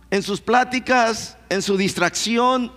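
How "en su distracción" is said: ordinary talk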